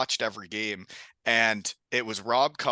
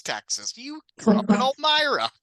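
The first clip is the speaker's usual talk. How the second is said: exaggerated Southern drawl, pitched way up; mock Texan drawl; 12 semitones higher, 4 dB louder than usual. Teasing a teammate who came back sounding Texan, mock Texan drawl. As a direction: tease a buddy with a put-on Texan accent. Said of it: "he's talking like a Texan".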